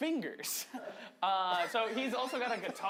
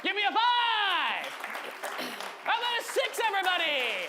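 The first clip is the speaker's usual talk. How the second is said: hype host counting up; 10 semitones higher, 7 dB louder than usual. Real, not acted. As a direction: pump the crowd up, louder each number. Hyping up crowd, counting up.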